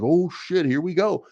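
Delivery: lightly acted rush of anticipation; mock FOMO excitement. Imagining a viewer feeling the Bitcoin rally start, mock FOMO excitement. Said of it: "you might get a fomo feeling".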